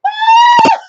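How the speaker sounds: very high, loud squeal of delight; excited shriek. Retold or imitated. Fan reacting to Jack showing up, with a high, loud squeal. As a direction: shriek like a fan seeing a favourite return. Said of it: "and I'm like".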